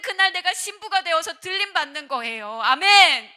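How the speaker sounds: high, very loud impassioned sermon voice; full-force preaching. Real, not acted. Pastor exhorting the young congregation to live as the bride, forceful preaching. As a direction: drive the warning home at full volume.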